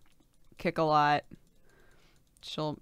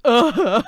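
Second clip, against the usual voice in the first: exaggerated frustrated groan; big annoyed ugh; loud ugh groan; 7 semitones higher, 14 dB louder than usual.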